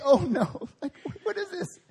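put-on high, laughing "oh no" as a kid; laughing panic. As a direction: panic through laughter at the mirror. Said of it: "I was like".

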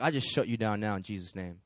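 low, quiet rebuke; silent prayer. Acted. Woman praying against the warlock in her head, quiet authority. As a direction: pray it low and matter-of-fact. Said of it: "she prayed in her head".

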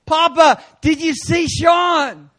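loud, slow, delighted call; Jesus shouting with joy over Sean; happy Jesus. Retold or imitated. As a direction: call out proudly like a beaming father. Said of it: "Jesus was as happy as I was".

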